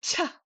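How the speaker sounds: "Cha" high, with a strong puff of air; forceful, heavily aspirated "Cha"; way too hard. Shown as a demonstration. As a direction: blast the ch of "Cha". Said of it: "high tone and way too hard".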